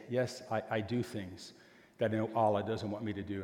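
quiet admission. Retold or imitated. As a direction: confess it softly, a little ashamed. Abdul admits wrongdoing, eyes on food.

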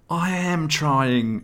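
high, slow desperate plea; pleading. A narrator's reading. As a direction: beg to be let off. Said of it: "Nolson pleaded".

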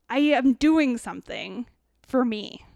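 ordinary talk

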